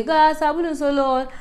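high, loud singsong street cry; hawker's call. Retold or imitated. Imitating northern soap hawkers, high ringing call. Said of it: "that people say".

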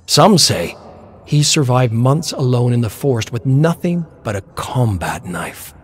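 plain reading voice

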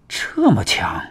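hushed, breathy gasp of shock; startled half-whispered exclamation. A narrator's reading. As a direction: blurt it under the breath, taken aback. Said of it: "李云霄一惊".

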